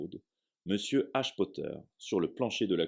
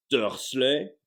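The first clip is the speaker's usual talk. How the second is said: loud, higher yelled name; 6 semitones higher, 7 dB louder than usual. Acted. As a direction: bellow the name across the room. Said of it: "hurla-t-il".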